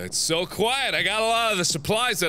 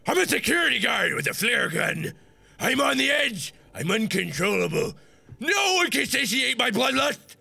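hammy tough guy; 4 semitones higher than usual. Pretend.

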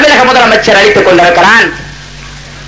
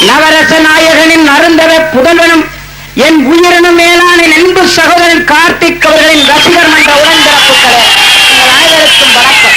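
rising, ringing rally address building to shouts; thunderous political oratory; 7 semitones higher than usual. Real, not acted. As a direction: hail the crowd like a stage orator. Rousing greeting to the crowd. (முழங்கும் அரசியல் மேடைப் பேச்சு)

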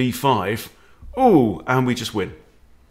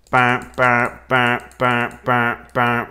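evenly punched "ba" on one pitch; rhythmic "ba ba" noises; 4 dB louder, much slower than usual. Shown as a demonstration. Chess teacher sounding out quick moves, rhythmic vocal noises. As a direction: tap out a steady "ba, ba, ba" beat.

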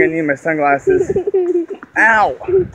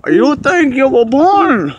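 Bane voice; 8 semitones higher, 4 dB louder, slower than usual. Retold or imitated. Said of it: "you think darkness is your ally".

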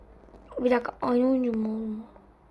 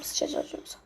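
ordinary talk

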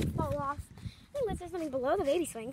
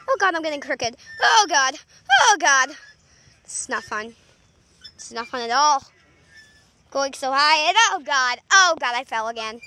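louder, alarmed oh God; distressed; 3 semitones lower, 10 dB louder than usual. Real, not acted. Kid slipping off the swing, alarmed and loud. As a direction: yelp in dismay.

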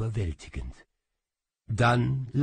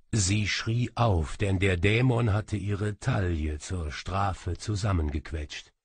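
ordinary delivery

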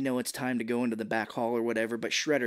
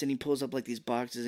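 ordinary talk, nothing marked

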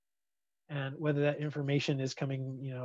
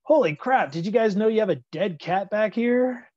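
higher and louder, a startled cop yelling; shocked cop yell; 7 semitones higher, 11 dB louder than usual. Acted. Cop finds a cat in the trunk, startled yell. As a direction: blurt it out like a cop who just found a dead cat. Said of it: "and all of a sudden he goes".

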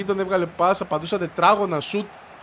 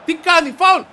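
high, loud protest; outraged foul call; 12 semitones higher, 11 dB louder than usual. Real, not acted. Gamer yelling foul at the referee. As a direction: shout foul at the screen.

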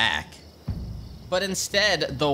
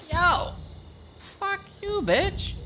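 much higher, cocky squeaky voice; mock insult; 13 semitones higher, slower than usual. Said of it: "he's like".

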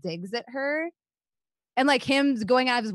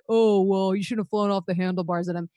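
mocking critic. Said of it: "some people are gonna be like".

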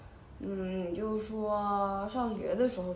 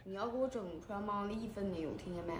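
quiet threat; 10 dB quieter, faster than usual. Acted. Low threat about the money.

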